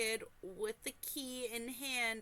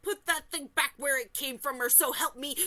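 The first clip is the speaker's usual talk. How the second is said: loud scolding granny threat; stern grandmother; 5 semitones higher, 8 dB louder than usual. Retold or imitated. Reading a comment as her stern grandmother, loud. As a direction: bark it like an angry old granny. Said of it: "That would be my grandmother".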